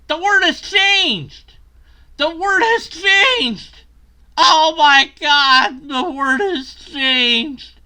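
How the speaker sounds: high, slow, loud wail; hammed-up shout. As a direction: belt it out in mock shock. Mock dramatic outburst.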